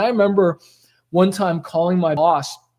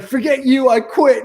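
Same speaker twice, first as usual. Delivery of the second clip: imagined angry outburst; 5 semitones higher, 3 dB louder than usual. Retold or imitated. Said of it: "just saying, like".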